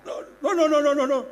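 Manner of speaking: high, loud string of denials; hasty refusal. Acted. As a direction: back out in a hurry. Hurriedly backs out of the task.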